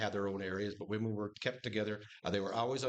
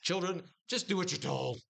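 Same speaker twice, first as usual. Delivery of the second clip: put-on higher-pitched order to children; insistent mock order; 7 semitones higher than usual. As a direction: lay down the rule, pitched high. Preacher voices parent-like order to children.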